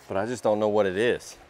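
ordinary delivery

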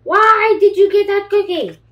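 high, loud outraged demand; pigeon shout. Acted. Pigeon shouting at the duckling about the cookie, high and loud. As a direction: yell it in jealous outrage.

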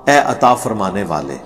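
usual speaking voice

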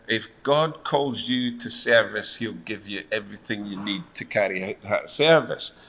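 ordinary talk, nothing marked

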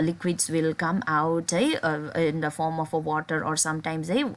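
ordinary delivery

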